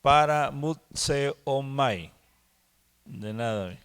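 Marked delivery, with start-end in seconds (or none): 0.0-2.1 Para mut seomai slowly, in separate chunks; slow, deliberate Para mut seomai; syllable-by-syllable dictation. Shown as a demonstration. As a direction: spell out Para mut seomai by ear for note-takers. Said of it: "con acento en la E".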